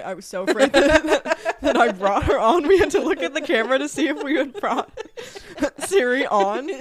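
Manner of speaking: loud, high, cracking up mid-sentence; laughing hard. Real, not acted. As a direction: tell it through helpless real laughter. Cracking up over setting off Siri.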